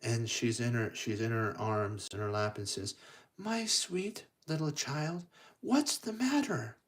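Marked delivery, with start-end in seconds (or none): 3.4-6.8 high, soft, tender godmother voice; sweet, high-pitched concern; fairy godmother. Acted. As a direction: coo it gently to a crying child. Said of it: "the fairy godmother appears".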